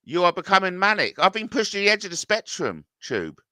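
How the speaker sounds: ordinary talk, nothing marked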